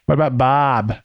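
put-on thick Boston accent, "Barb" as "Bob"; hammed-up Boston "What about Bob". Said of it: "thick Boston accent".

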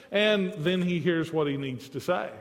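usual speaking voice

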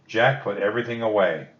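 usual speaking voice

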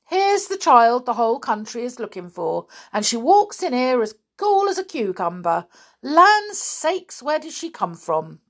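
high, loud astonishment; startled. A narrator's reading. Inspector finds the child the country seeks, startled. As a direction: marvel excitedly at the find. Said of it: "in a startled voice".

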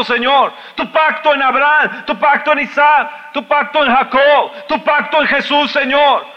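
high, loud impassioned preaching; shouting sermon, pleading with God; fiery preaching. Acted. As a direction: preach it at full force, crying out to God. Preacher at full force, high and loud.

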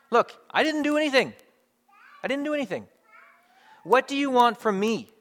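high, indignant self-defence; acted indignant outburst, pitched well up; defensive protest. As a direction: plead innocence with mounting exasperation. Acted defensive denial, higher pitch.

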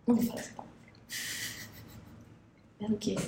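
whisper. Real, not acted. Avoiding swear word.

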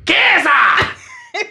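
usual speaking voice

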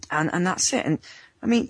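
ordinary talk

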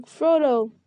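mild searching call. A narrator's reading. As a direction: call out for someone, lightly acted. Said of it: "panting and calling".

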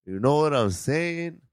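drawn-out Mexican accent. Shown as a demonstration. Checking the listener gets it, slow Mexican accent. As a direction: stretch it slowly in a Mexican accent. Keeps demonstrating the taught accent.

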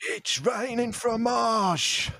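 ecstatic shout. Acted. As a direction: bellow it out like a miracle is raining down. Ecstatic cheese shower scene.

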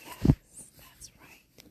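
barely-there, breath-only ASMR reassurance; ASMR character. Acted. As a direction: whisper soothingly, as if tucking someone in. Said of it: "an A S M R character".

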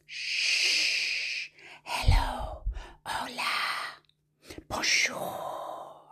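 hushed whisper; soft, airy storytime whisper; as softly as possible. Shown as a demonstration. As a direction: whisper gently, stretching each greeting. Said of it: "as softly as we can".

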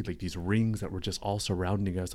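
usual speaking voice

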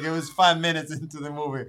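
ordinary talk

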